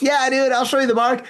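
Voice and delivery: high-pitched, animated retold answer; eager reply. Telling Steven he'll show the mark, eagerly.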